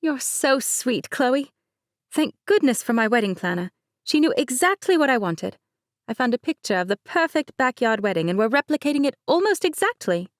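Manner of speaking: Mercedes voice. Acted. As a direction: play the bride breezily.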